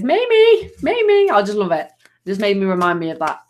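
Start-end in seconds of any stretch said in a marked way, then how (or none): 0.0-1.3 put-on much higher, louder childlike calling of the name; imitating her nephew. Aunt imitating her nephew calling her name, high childlike call. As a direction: call Mamie like a little boy wanting attention. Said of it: "He goes".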